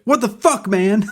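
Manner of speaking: high-pitched indignant outburst; hammed-up outraged protest; mock outrage. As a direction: blurt it out in acted disbelief. Acts out hypothetical outraged reaction.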